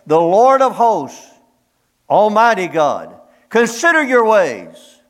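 high, loud and slow, thundering out scripture; booming revival preacher; full-force preaching. Acted. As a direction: proclaim it from the pulpit, slowly and loud.

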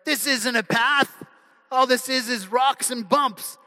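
a hint of a doubtful little sister; little girl voice. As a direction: pitch up a little as a complaining girl. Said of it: "the little girl looks at him and says".

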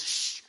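sharp "shh" to silence someone; urgent shush. Acted. Lion hushing the man in gorilla suit, urgent shush. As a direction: hush the yeller.

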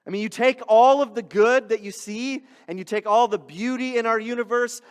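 impassioned preacher build, no shouting; preaching. Acted. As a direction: rise up to the big point about Jesus. Building sermon climax.

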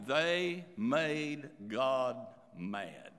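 "they made God mad" very slowly, word by word, dropping low on "mad"; strong emphasis. Real, not acted. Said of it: "in a strong emphasis here".

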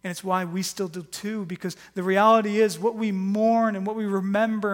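loud, rising sermon climax; forceful preaching. Acted. Pastor urging congregation on Good Friday, loud fervent preaching. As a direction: build it up, driving the point home.

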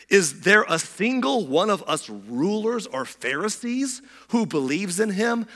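slow, high-pitched scornful question; mocking. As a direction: scoff in a lifted, drawn-out voice. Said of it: "They mocked".

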